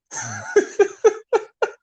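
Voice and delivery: high-pitched burst of laughter. Real, not acted. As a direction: crack up at your own logic. Laughing at own joke.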